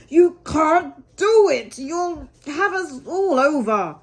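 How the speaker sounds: loud, slow warning shout; alarmed cry. A narrator's reading. Rat warning Mole the boat will tip, loud and slow. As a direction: yell a panicked warning. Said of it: "cried the Rat".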